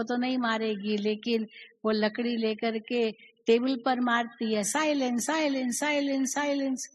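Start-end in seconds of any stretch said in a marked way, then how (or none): 4.6-6.9 a lightly voiced teacher shouting silence; understated classroom yell; mock shouting teacher. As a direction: play the fed-up teacher, half-hearted. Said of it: "वो चिल्लाती रहती है".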